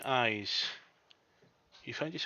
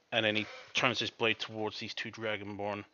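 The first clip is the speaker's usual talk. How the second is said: ordinary delivery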